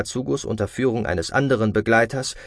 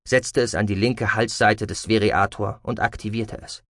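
plain reading voice

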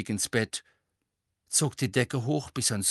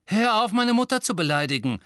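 loud, high snarl of protest; angry snap; 10 semitones higher, 6 dB louder than usual. A narrator's reading. Seamus defending his mother to Harry, snapping angrily. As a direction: snap back in indignation. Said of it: "fauchte Seamus".

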